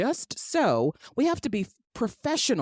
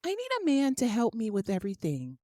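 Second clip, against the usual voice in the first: higher, softer, needy damsel voice; mock helpless woman, softer and higher; 5 semitones higher, 4 dB quieter than usual. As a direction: beg sweetly like you can't manage anything. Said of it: "you also have to be".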